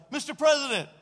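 high, loud fan shout; excited calling out. Retold or imitated. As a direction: holler to get a star's attention. Calling out to passing president.

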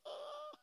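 high-pitched, awestruck "ah"; starstruck squeal. Retold or imitated. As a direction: let out a tiny high squeal of awe. Retold starstruck gasp at the singer.

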